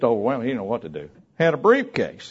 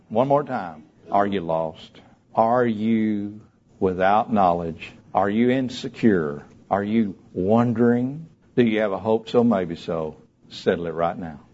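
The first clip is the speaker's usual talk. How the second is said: low, measured appeal; earnest closing invitation; solemn altar call; 5 semitones lower than usual. Real, not acted. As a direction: ask each question gravely and gently.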